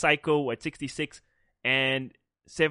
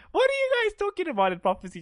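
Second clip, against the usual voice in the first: high mock innocence. Retold self protest.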